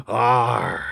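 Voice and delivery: creature grunt. Acted. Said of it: "I concur".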